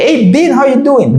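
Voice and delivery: high chirpy greeting as Y; mimicking Y. Greeting voiced for Y.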